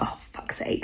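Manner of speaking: voiceless, exasperated whisper; whispered curse. Retold or imitated. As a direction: hiss the curse under your breath in mock embarrassment. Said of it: "She's like".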